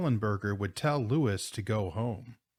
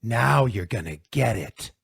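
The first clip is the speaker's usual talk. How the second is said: loud, menacing warning; snarling threat; 5 dB louder than usual. A narrator's reading. Bully with fists up, snarling. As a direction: threaten him hard before swinging. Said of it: "he snarled".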